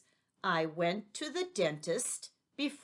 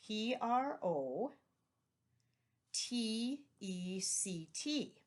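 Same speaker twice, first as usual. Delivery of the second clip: slow, even spelling of "P R O T E C T", each letter apart; teacher spelling the word protect for the class, slow and careful; spelled letter by letter; 3 semitones higher, slower than usual. Shown as a demonstration. Letter by letter, unhurried. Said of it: "The next one is protect".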